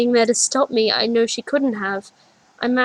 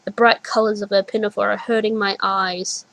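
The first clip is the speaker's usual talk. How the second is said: plain reading voice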